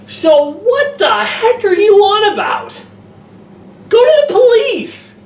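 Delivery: can't believe nobody calls the police; exasperated disbelief. Retold or imitated. Reviewer rants at the movie characters, high exasperated disbelief. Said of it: "I'm like".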